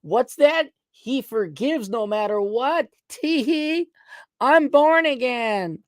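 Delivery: high, slow giggly mock voice with a tee-hee; high, slow, simpering parody of a born-again. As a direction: play a smug, simpering believer, high and slow. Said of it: "And they're like".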